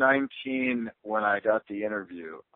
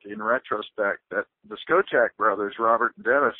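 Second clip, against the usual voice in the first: ordinary talk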